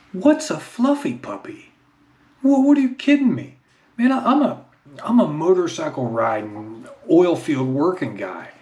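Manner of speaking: high-pitched comic disbelief; indignant, high-pitched retort; mock incredulity. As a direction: protest as if utterly baffled. Acted reaction to wife's puppy request.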